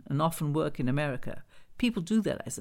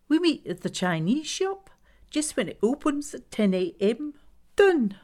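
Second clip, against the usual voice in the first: high, decisive arranging voice; bossy Glenda character; 9 semitones higher, 3 dB louder than usual. Acted. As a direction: pin the listener down in a high voice. Glenda's voice in narrated story.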